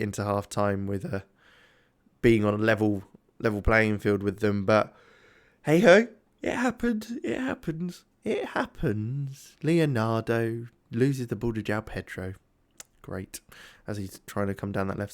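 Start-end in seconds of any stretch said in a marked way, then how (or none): 5.6-9.6 lilting resigned hey ho; sing-song resignation. Real, not acted. Resigned sing-song after conceding.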